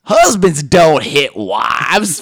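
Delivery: slow, loud TV counselor; very slow and emphatic, playing to the crowd; Doctor Phil. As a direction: deliver it as a grand, drawn-out pronouncement. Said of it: "says obvious shit slowly".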